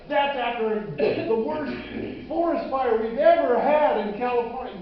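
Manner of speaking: pleading his case, high and loud; loud protest. Acted. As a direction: argue loudly to keep your savings. Protesting loss of piggy bank.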